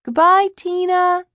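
high, loud, stretched-out farewell; shouted goodbye. A narrator's reading. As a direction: call goodbye in a high child's voice. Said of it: "he shouts".

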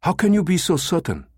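high-pitched, doubting demand; agitated challenge. A narrator's reading. Numataka doubting the American's promise, agitated.

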